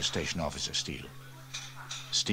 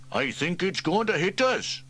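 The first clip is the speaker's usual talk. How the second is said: higher, calmly noting; matter-of-fact fireman; 10 semitones higher, 5 dB louder than usual. Acted. Elvis calmly points out the falling extinguisher, mildly. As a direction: state it mildly. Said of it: "observed Trevor Evans".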